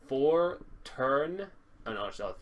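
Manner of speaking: ordinary delivery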